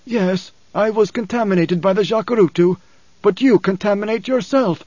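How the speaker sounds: high-pitched, wounded character voice; Paul, embittered father. A narrator's reading. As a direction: voice an anguished father accusing his son.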